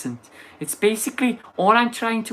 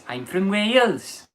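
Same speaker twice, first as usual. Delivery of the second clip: put-on Welsh accent; 5 semitones higher, slower than usual. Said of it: "trying to do a Welsh accent".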